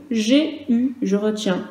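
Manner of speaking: usual speaking voice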